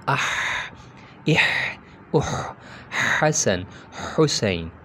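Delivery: आह इह उह हसन हुसैन with a tight middle-throat ḥ; Arabic throat ḥa drill. Shown as a demonstration. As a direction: push the ḥ from the middle throat, narrowed windpipe. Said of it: "ज़रा stress होगा वहाँ पर".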